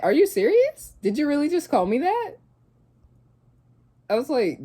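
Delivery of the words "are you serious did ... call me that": shocked disbelief. Retold or imitated. Telling the listener how she reacted to the boyfriend, stunned and higher. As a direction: replay the shock of hearing the insult. Retelling shock at being called bum.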